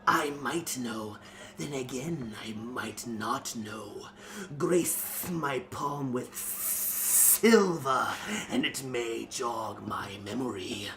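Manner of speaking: slow, sly snake voice, lightly done; drawn-out serpent tease, only lightly voiced; hissing cobra man. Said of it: "hissed the Cobra Man".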